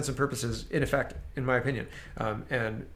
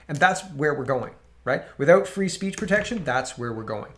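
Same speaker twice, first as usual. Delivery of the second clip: ordinary delivery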